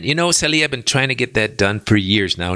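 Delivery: usual speaking voice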